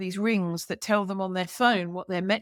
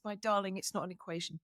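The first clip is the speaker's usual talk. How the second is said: much softer, hushed and tender; tender reassurance; 10 dB quieter than usual. Retold or imitated. To the anxious man, a soft tender reassurance. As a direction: soothe him gently, nearly murmured. She comforts cancer-worried man.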